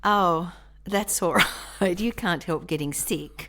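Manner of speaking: words broken up by a laugh; laughing reassurance. Real, not acted. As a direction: let him off, breaking into laughter. Laughs while accepting his apology.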